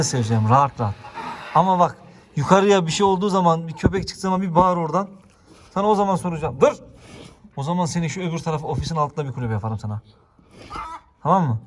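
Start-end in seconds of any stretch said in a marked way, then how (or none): 1.5-6.7 higher, louder warning to the dog; scolding the dog. Real, not acted. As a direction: warn the dog firmly.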